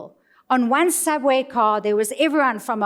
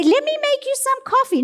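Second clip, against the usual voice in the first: high, fast chirpy offer of coffee; sweet eager offer; 13 semitones higher, much faster than usual. Retold or imitated. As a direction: play the keen receptionist offering coffee. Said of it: "And I would say".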